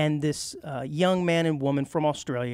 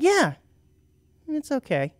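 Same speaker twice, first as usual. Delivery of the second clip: quoted reply; 10 semitones higher, slower than usual. Retold or imitated. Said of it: "I was like".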